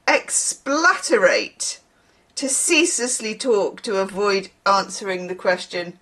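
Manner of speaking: ordinary talk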